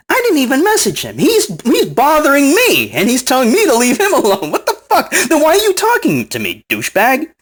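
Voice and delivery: outraged that he says leave me alone; incredulous rant. Real, not acted. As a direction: rant in high disbelief. Indignant rant about him.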